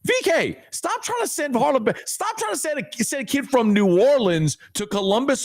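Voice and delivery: high-pitched, half-joking plea; exasperated plea. Real, not acted. Host pleading with V K to stop sending recruits away, high exasperated voice. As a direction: beg him to stop, voice jumping up.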